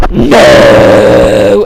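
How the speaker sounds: very low, loud, drawn-out "No!"; deep roaring villain wail; Darth Vader. A narrator's reading. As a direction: bellow a long, hammed-up cry of despair. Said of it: "as if he were Darth Vader".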